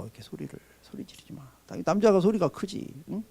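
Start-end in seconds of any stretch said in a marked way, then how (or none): 0.0-1.4 whispered, much softer; hushed demo. Shown as a demonstration. Pastor telling men not to yell, in a whisper. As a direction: drop to a whisper to show no shouting. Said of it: "소리도 지르지 마".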